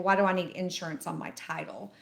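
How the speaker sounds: normal talking voice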